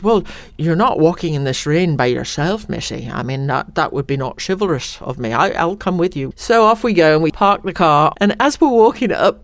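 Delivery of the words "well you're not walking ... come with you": Sean Connery. Retold or imitated. Star offering to walk her through the rain, imitated Scottish voice. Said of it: "and Sean says".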